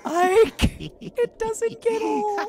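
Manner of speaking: laughing. Real, not acted. Laughing at the prank.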